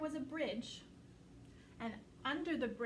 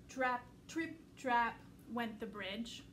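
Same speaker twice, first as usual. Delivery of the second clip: ordinary delivery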